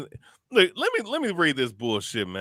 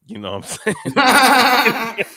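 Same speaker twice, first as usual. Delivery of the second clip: laughing loudly, slowed way down; cracking up while asking, much louder; 9 semitones higher, 7 dB louder, much slower than usual. Real, not acted. Host laughing at a rival's tweet, laughing loudly.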